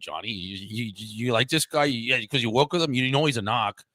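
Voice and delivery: a Southie tough guy mocking him in a Boston accent. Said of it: "we're just doing Boston, Boston accents".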